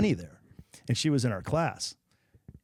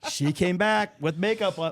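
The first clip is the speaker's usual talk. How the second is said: usual speaking voice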